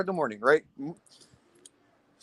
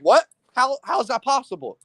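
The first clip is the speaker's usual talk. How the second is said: retold disbelief; 6 semitones higher, 3 dB louder than usual. Retelling disbelief at enemy streak.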